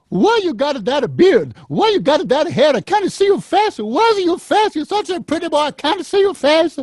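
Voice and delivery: put-on very high, fussing old Italian grandmother; grandma scolding. Grandmother scolding her bearded grandson, very high fussing voice. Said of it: "she goes".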